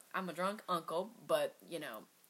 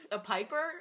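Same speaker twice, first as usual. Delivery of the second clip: usual speaking voice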